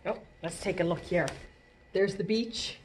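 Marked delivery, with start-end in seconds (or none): none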